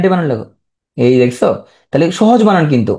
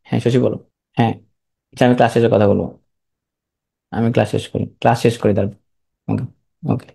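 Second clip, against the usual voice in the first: softer, lower word to one student; quiet aside; 9 semitones lower, 6 dB quieter than usual. Real, not acted. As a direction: drop the voice for a private word. Teacher aside to student mid-class. (ক্লাসের মাঝে এক ছাত্রকে নিচু স্বরে বলা)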